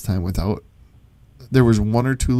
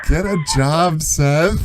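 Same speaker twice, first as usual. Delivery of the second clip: imitating drunk girl; 6 semitones higher, 3 dB louder, much slower than usual. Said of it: "You're like".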